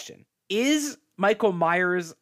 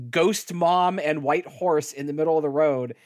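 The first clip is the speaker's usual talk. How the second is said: usual speaking voice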